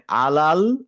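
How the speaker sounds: আলাল with a light, thin l and a-vowels; light laam. Shown as a demonstration. Teacher isolating a word, আলাল with light l. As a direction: keep both vowels of আলাল open as la. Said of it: "আলা পর্যন্ত ঠিক আছে".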